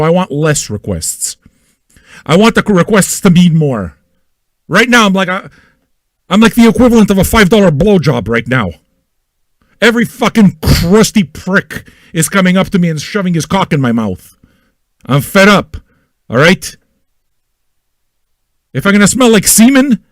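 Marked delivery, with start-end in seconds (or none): none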